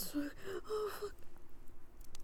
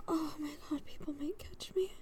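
whispering; 7 dB quieter than usual. Real, not acted. Afraid of being caught, whispering.

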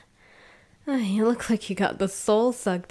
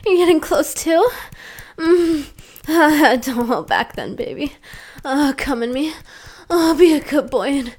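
loud, high, crying out; building climax; 5 semitones higher, 9 dB louder than usual. Acted. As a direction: let the moans climb higher and louder.

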